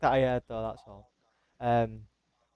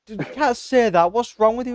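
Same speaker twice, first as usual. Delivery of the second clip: imitating scolding Brian; 9 semitones higher, 6 dB louder than usual. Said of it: "He's like".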